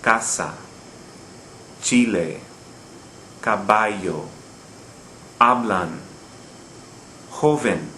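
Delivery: CAsa, CHIle, caBAllo, HAblan, JOven; second-to-last syllable stress. Shown as a demonstration. Teacher giving Spanish examples, Casa to joven each stressed on the second-to-last syllable. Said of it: "Notice the stress on the second to last syllable".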